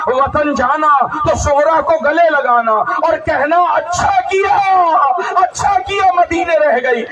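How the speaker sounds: mourning wail; keening lament. Acted. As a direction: sob the message out. Sakina's message to Sughra.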